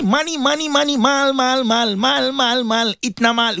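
high, insistent repeated chant; mock money obsession. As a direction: harp on it over and over, pitched high. Mocking people's obsession with money.

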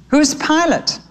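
put-on high-pitched childlike question; curious little sparrow. A narrator's reading. Brother asks Harrow about Pilate, high and curious. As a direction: ask it high, like a puzzled little bird.

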